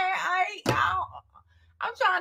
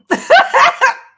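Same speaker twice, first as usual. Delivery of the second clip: high laughing fits; 11 semitones higher, 12 dB louder than usual. Real, not acted.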